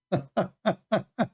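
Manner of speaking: real laughter breaking out, high; can't help cracking up; giggling in disbelief. Real, not acted. Laughing at Biden's troop move.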